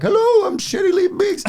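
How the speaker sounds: very high loud falsetto lady voice; co-host in drag as Mrs Doubtfire, screeching his entrance. Retold or imitated. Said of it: "he comes on being like".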